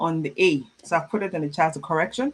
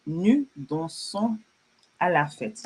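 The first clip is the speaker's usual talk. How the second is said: very slow with a pause after "dansons"; slow model reading; slower than usual. Shown as a demonstration. French teacher repeats a student's sentence for learners, slowly. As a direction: spell it out by ear, word by word.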